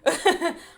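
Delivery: loud, high burst of laughter; big laugh. Real, not acted. Laughing out loud at the viewers. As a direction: crack up at your own joke.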